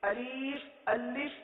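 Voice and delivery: long dragged ee in the second syllable of الف; wrong pronunciation. Shown as a demonstration. Teacher says not to read it so, overlong الف. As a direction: stretch الف into "aleeef". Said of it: "نہیں پڑھنا".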